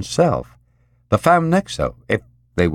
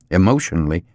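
plain reading voice

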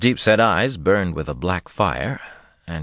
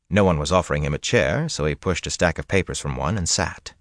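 ordinary delivery, nothing marked

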